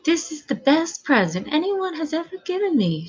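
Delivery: high, lightly beaming delight; a touch of beaming joy at the gift. Acted.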